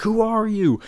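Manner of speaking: high, loud mock scream; retold frantic demand, pitch shooting up. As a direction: imitate someone screaming their head off. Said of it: "like, screaming her head off".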